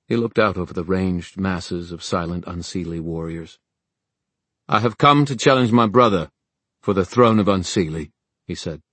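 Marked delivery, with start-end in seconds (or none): 4.7-8.1 raised, loud formal challenge; proud, ceremonial declaration; Kieran, formal challenger. Acted. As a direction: proclaim the challenge before warriors.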